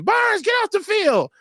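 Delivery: high, slow yell from the sideline, like the whole bench calling him off; mock the crowd shouting his name off the field. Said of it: "Everybody and their mama was saying".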